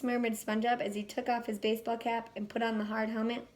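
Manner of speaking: ordinary delivery, nothing marked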